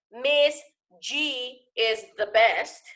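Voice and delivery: slow, deliberate reading aloud; slow example reading. Shown as a demonstration. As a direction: spell the sentence out slowly. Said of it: "if I say".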